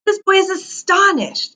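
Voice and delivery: a high, slow amazed voice. Acted. Teacher showing the boy's amazement, high and slow. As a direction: sound astonished yourself.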